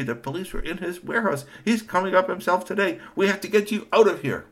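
urgent, flustered warning; upset uncle. A narrator's reading. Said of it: "Uncle Hiyoshi said".

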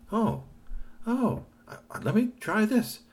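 put-on high eager kid voice; eager boy, pitched high; high excited boy. A narrator's reading.

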